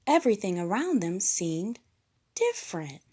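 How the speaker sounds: plain reading voice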